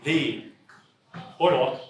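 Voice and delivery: one word per speaker, halting; halting word-by-word delivery; one word at a time. Pretend. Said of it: "They can only speak one word at a time".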